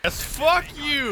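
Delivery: gleeful taunt. Real, not acted. Gamer taunting kill.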